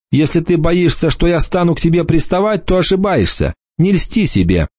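высокий задорный девичий голос; дразнит, поддразнивает свысока; насмешливая девушка. A narrator's reading. Голос Эммы в рассказе. (Эмма дразнит рассказчика, насмешливый девичий голос)

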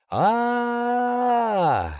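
long, high-pitched drawn-out "aaah"; falling scream. Acted. Man hurled overboard into the sea, falling scream. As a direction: hold a high scream, then drop off.

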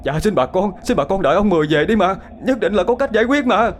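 high, desperate begging; kneeling husband begs the villagers to wait; pleading. A narrator's reading. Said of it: "quỳ xuống van xin". (van xin tha thiết, giọng cao vút)

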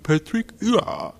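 Patrick Huard high and slow in a mocking French accent. Mocking English speakers who fake French, exaggerated French accent. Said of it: "anyone who goes like".

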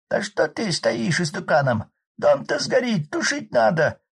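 high loud rough village man; hoarse peasant shout. Acted. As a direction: bellow over the roaring fire. Arkady shouting over fire.

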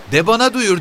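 very high-pitched, defiant challenge; defiant woman. A narrator's reading. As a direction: stand up to him, shrill and high. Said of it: "diye diklendi kadın". (tiz sesle diklenerek)

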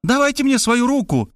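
high, insistent woman's voice; woman reaching out of the trench; urgent demand. Acted. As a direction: push him to grab her hand.

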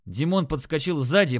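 ordinary delivery, nothing marked

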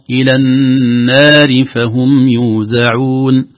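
slow chanted verse, the vowels held; tajweed recitation. Shown as a demonstration. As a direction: recite slowly with full madd. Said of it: "لا نقول". (تلاوة مرتلة بطيئة)

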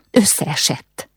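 understated tremble of distress; trembling voice. A narrator's reading. Ilka reporting her father's collapse, understated trembling voice. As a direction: answer with a touch of despair. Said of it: "Ilka hangja remegett".

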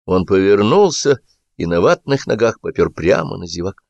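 ordinary delivery, nothing marked

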